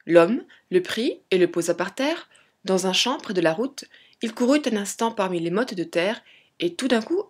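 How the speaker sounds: plain reading voice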